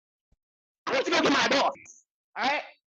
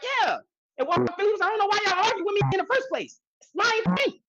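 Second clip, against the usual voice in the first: boss gloating; 9 semitones higher than usual. Retold or imitated. Boss boasting to guard.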